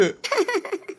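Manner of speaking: short high giggle at her expense; high teasing laugh. Acted. Husband teases her lightly. (கிண்டலான உயர்ந்த சிரிப்பு)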